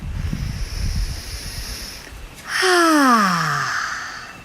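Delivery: long voiced "ah" sigh. Shown as a demonstration. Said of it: "add a little bit more sound".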